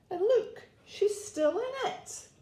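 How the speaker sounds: very high, tiny cub voice; astonished Baby Bear. A narrator's reading. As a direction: squeak the discovery like a little bear. Baby Bear discovers Goldilocks.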